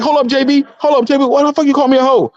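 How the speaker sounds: high, mock-offended woman's voice; indignant woman. Woman snapping back at JB who called her a hoe, high and indignant. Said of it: "They be like".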